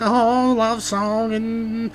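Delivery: high, slow mocking singer voice; mock a swooning pop singer; mock girly singer. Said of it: "She's like".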